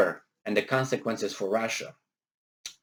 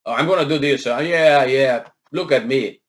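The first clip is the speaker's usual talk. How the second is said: louder, hammed-up show-off; lightly mocked idiot; 6 dB louder than usual. Mocking the CIA director's view of Putin, hint of loud idiot. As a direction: play a hint of a boastful fool. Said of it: "this sounds like Putin is an idiot".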